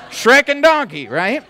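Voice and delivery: high, loud cartoon donkey voice; Eddie Murphy's Donkey. Preacher riffing on Shrek, hammed-up high donkey voice. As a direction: jump way up into a jabbering cartoon donkey. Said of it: "It's the voice of Eddie Murphy".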